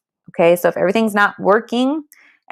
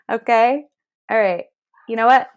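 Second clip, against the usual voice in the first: ordinary talk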